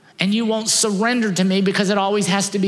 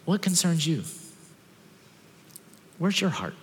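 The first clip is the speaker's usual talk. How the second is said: soft probing question; 7 dB quieter than usual. Acted. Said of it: "And he's asking".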